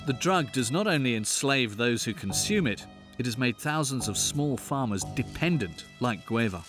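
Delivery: nature documentary. A narrator's reading. Documentary voice-over narration.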